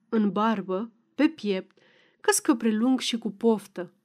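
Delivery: ordinary delivery, nothing marked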